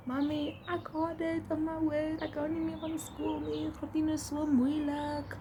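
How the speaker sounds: put-on high whiny little-kid voice; whiny child voice. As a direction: whine to mom like a child. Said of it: "telling my mama".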